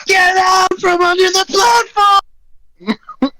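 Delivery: very high, shrieked warning; high-pitched in-character shriek; panicked scream. Acted. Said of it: "Sarah screams".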